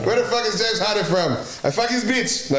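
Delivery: lightly voiced Giannis mouthing off; pretend Giannis trash talk. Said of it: "If I was Giannis".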